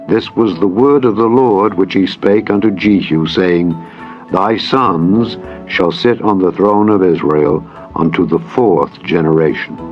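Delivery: usual speaking voice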